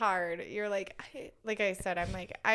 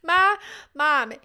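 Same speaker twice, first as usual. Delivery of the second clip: loud, higher call of mom; toddler calling out; 6 semitones higher, 12 dB louder than usual. Retold or imitated.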